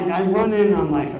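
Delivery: normal talking voice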